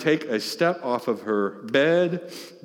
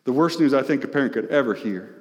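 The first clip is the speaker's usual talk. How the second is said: ordinary delivery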